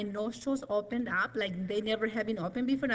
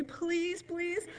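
small high-pitched begging "please"; pleading; 8 semitones higher than usual. Retold or imitated. Woman retelling her prayer to be healed, high-pitched pleading. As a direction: beg in a high voice, as in a retold prayer.

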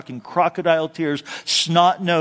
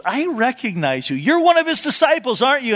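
put-on accusing servant girl; 8 semitones higher than usual. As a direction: point the finger, pitch up.

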